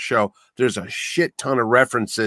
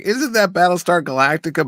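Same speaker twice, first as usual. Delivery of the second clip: surprised realization; 6 semitones higher than usual. Retold or imitated. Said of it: "I was like".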